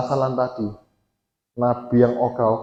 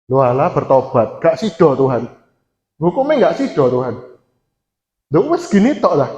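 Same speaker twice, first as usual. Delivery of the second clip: high, loud indignant whining at God; a resentful prophet protesting the pardon, pitched up and loud; sulky complaining Jonah; 8 semitones higher, 5 dB louder than usual. Retold or imitated. Jonah complaining to God, loud indignant whine.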